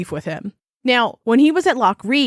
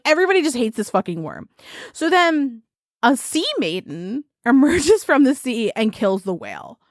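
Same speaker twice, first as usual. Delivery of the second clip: normal talking voice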